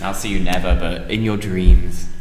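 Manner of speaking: slightly creepy. Pretend. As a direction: drop low and draw it out, mock-sinister. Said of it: "Oh, that's slightly creepy".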